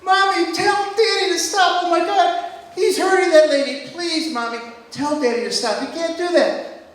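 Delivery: put-on high, loud child's wail; upset little boy. Son Max begging Mommy to stop Daddy, high loud child's wail. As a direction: whine like a frightened seven-year-old. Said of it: "my son Max is in the back going".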